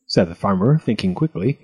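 ordinary delivery, nothing marked

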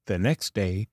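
ordinary delivery, nothing marked

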